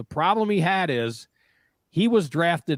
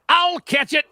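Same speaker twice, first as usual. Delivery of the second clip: mock player voice; 12 semitones higher, 5 dB louder than usual. Player voiced mid-play.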